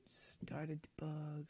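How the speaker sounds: whispered under his breath; whispering. Real, not acted. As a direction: whisper it to yourself. Muttering to himself while coding.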